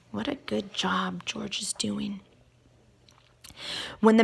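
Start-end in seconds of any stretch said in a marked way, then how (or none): 0.1-2.2 breathy whispered aside. Acted. Reader whispers praise of George to the kids. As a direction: whisper it as a secret to the children.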